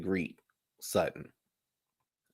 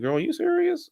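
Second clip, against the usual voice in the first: much higher squeal of disbelief. Retold or imitated. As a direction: act shocked at her. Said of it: "I was like".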